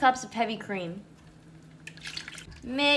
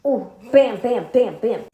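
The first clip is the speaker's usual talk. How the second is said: loud punchy bam; hyping it up loudly; excited; 7 dB louder than usual. Real, not acted. Excited tasting reaction.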